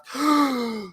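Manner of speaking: big, sharp gasp for air; deep breath. Real, not acted. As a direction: suck in a huge breath mid-list. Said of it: "Should Taking a Breath".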